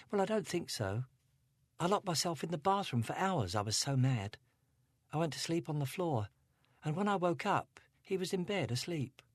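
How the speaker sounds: Angela, a hint of upset teen; teenage girl. A narrator's reading. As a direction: voice her as a troubled teenage girl. Angela voiced.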